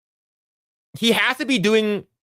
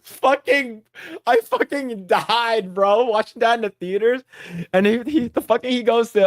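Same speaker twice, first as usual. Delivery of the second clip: normal talking voice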